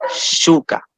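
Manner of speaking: "Shuka" with force on the U; stress on marked vowel. Shown as a demonstration. Teacher showing the written accent, U stressed in "Shuka". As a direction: lean on the U of "Shuka", light final A. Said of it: "pronunciamos encima de esa vocal".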